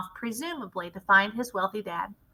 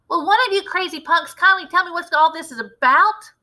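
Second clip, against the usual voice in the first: much higher, louder angry demand; shouting; 8 semitones higher, 7 dB louder than usual. A narrator's reading. Bruno shouts at the witches, angry and loud. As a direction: shout at the crazy crowd. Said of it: "shouted Bruno".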